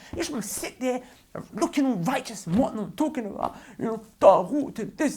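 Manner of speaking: high, loud exasperated outburst; real angry rant, voice pushed high; annoyed. Real, not acted. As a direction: shout at them, fed up. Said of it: "I'm getting annoyed".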